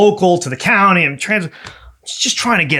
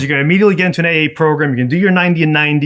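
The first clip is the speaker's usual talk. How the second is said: lawyer's orders; much faster than usual. Retold or imitated. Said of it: "He goes".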